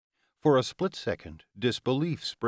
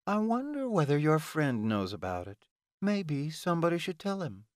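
higher-pitched sly threat; hint at it mockingly in a raised voice; bitter, taunting Ilona; 7 semitones higher than usual.

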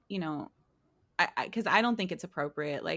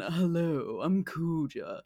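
slow, low villain voice; a lightly voiced theatrical villain; Kuja voice; 3 semitones lower, slower than usual. Shown as a demonstration. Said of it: "I give him a voice that's like Jesse".

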